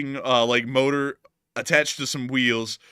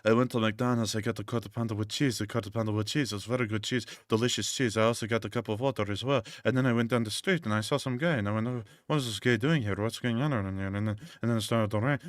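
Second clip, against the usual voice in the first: droning, softer mock monotone; soft flat droning parody, quicker toward the end; monotone tweaker; 3 semitones lower, 7 dB quieter than usual. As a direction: imitate a drugged-out narrator droning on. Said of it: "talking in the most monotone voice".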